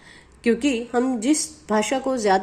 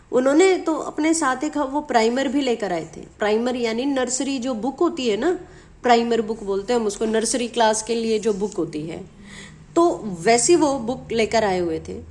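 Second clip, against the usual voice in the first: ordinary talk, nothing marked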